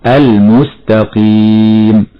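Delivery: slow, the ل of المستقيم said fully before م; careful recitation; clear lam. Shown as a demonstration. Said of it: "هكذا".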